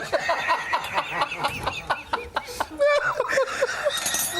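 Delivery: long high-pitched laughing fit. Real, not acted. Laughing at co-host's burp.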